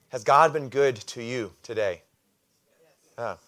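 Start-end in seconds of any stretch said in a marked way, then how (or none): none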